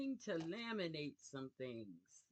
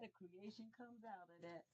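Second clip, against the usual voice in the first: much softer hushed repeat; soft echo; 2 semitones higher, 12 dB quieter than usual. Shown as a demonstration. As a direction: let it drop to a murmured echo. Echoes own phrase softly.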